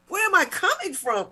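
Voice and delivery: high-pitched mock-indignant echo; annoyed. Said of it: "'cause I'm getting annoyed".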